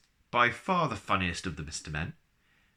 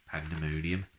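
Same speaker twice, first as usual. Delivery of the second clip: ordinary delivery, nothing marked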